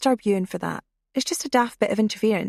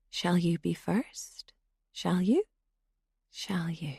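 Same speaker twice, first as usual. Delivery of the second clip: slow menacing whisper; creepy whisper; 6 dB quieter, slower than usual. A narrator's reading. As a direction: breathe it over a sleeping victim. Intruder with a blade.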